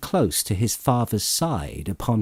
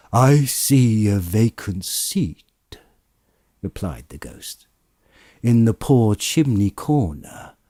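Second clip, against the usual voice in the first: a ghost foretelling a death, heavy and measured; solemn ghost of Christmas Present. Acted. Ghost foretells Tiny Tim's death.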